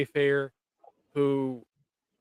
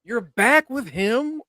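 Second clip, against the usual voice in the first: louder, higher, slow and accusing; accusing outburst; 7 semitones higher, 5 dB louder, slower than usual. Retold or imitated. Claudia accusing her father, loud, high and slow. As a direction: drag it out in disbelief. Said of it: "Claudia ripped into him".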